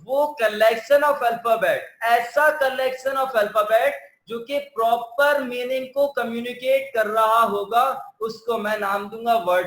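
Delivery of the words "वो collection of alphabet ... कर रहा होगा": very slow, careful definition; slow down so they pay attention; slow emphasis. Shown as a demonstration. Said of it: "यहाँ पे तवज्जो के साथ सुने".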